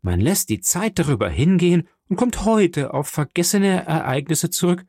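high-pitched, outraged protest; indignant young Morcerf. Acted. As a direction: protest the disgrace heatedly.

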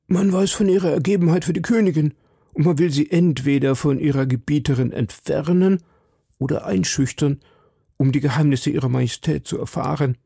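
high-pitched anxious citizen; worried citizen. Acted. Husband explaining his wife's danger to D'Artagnan, worried citizen.

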